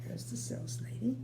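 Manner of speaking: usual speaking voice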